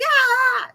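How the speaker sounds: sudden high shriek; a yelp of fright at a jumping spider; startled shriek. Retold or imitated. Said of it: "you're like".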